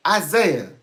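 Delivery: loud, high ISAIAH with a falling end; parent's stern call. Retold or imitated. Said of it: "that's actually when they would say".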